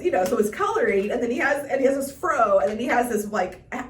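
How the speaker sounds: normal talking voice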